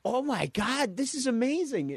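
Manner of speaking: high, gushing imitation; mock delight. Chi-Chi given a million bucks, high mock delight. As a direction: gush like an overjoyed cartoon wife.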